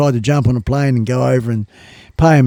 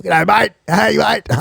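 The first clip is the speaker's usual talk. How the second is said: high, punchy mock Aussie greeting; Australian accent; 6 semitones higher, slower than usual. Shown as a demonstration. Alternating with the Kiwi voice, exaggerated Australian accent. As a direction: toss out a hammed-up Australian accent. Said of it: "how you talk Kiwi and Australian".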